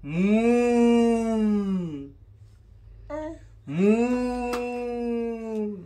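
slow mooing cow sound. Pretend. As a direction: moo like a lazy cow. Parent voices cow.

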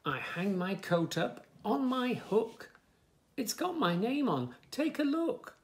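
lively, sing-song story reading; reading to children. Acted. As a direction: bounce the rhymes for little listeners. Reads book text.

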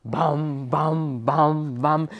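ordinary delivery, nothing marked

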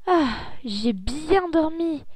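stretched-out whisper, just woken up; slow yawning whisper; sleepy waking whisper. Acted. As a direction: breathe it out slowly like you just woke. Waking up in the morning.

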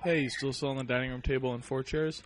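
ordinary delivery

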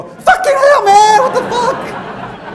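shocked high loud outburst through laughter; startled tourists. Retold or imitated. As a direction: jump out of your skin and swear. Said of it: "and they go".

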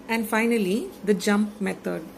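usual speaking voice

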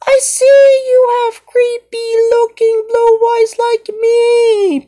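high, eerie doll voice; high-pitched creepy toy voice; creepy character voice. Pretend. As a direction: sound like a spooky little doll. Creepy toy character meeting Pinocchio.

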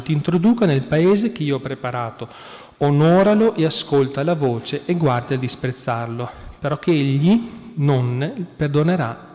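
proclamare la Scrittura a voce alta e piena; lettura biblica solenne. Acted. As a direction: leggere la parola di Dio con forza e lentezza. Reads Exodus passage aloud. (proclamazione solenne dall'Esodo)

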